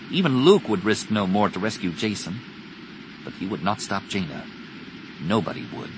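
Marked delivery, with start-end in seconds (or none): none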